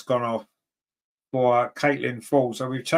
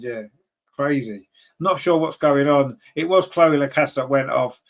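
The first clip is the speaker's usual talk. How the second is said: normal talking voice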